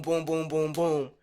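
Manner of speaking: ordinary talk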